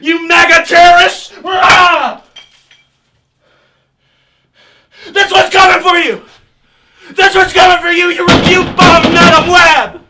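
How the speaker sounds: loud, high whiny yelling; trembling whiny yelps. Acted. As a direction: yelp out threats in a tantrum. Threatening outburst at the attackers.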